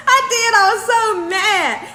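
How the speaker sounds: high, loud, amused outburst; mock-mad squeal; comic retold indignation. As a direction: exclaim it high, half laughing, drawing out the end. Retells being mad, laughing at herself.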